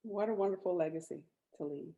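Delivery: ordinary talk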